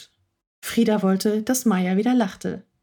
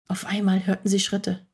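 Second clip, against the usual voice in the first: ordinary delivery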